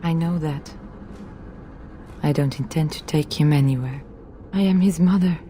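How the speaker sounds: low, grave, resigned plea; grim resignation. Acted. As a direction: speak low, resolved to do the unbearable. Mother resolves to end it herself.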